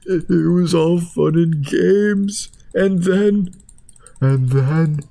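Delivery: slow, high squeaky storyteller; high slow cartoon voice. Sock tells the hot dog its horror, slow and high. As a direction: drag it out high and wounded. Food character tells its trauma.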